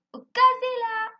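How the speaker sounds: very high, loud, drawn-out exclamation; explosive delight. Acted. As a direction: burst out with delight at the top of the range. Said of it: "exprimer ça dans un ton explosif".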